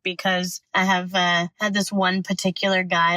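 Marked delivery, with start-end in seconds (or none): none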